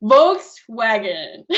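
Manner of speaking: Volkswagen, slow, V bitten and W kissed; slow model word. Shown as a demonstration. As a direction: model it slowly for the class to copy. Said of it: "So everybody follow me".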